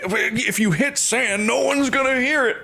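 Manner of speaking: high-pitched, drawn-out mock protest; hammed-up high disbelief; mock incredulous. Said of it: "he's like".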